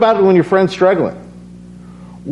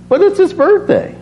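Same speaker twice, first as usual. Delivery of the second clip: incredulous protest; 13 semitones higher than usual. Retold or imitated. Retold protest to God.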